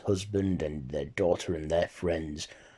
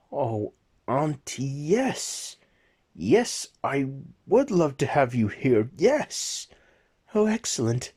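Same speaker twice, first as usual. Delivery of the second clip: high, thrilled and rising higher with each yes; overjoyed nephew; 7 semitones higher, 4 dB louder than usual. Acted. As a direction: burst with delight as the aunt accepts. Nephew hears aunt will come.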